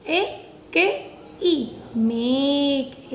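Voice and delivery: slow "ए के ई" then "मेक"; blending make. Shown as a demonstration. Teacher to pupils, blending MAKE. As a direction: sound out "मेक" slowly. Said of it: "blending MAKE for class".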